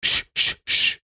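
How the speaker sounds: unvoiced sch sch sch like steam; whispered train puff. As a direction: puff like a little engine. Train roars away.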